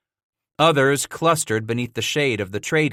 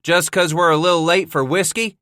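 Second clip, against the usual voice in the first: louder, higher teasing bellow; 7 semitones higher, 4 dB louder than usual. A narrator's reading. Scratch bellowing back at Jack from his horse. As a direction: shout it back from the saddle. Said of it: "he bellowed".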